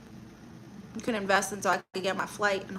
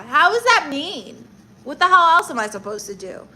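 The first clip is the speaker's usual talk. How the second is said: indignant; 6 semitones higher, 9 dB louder than usual. Real, not acted. Indignant reply to chat.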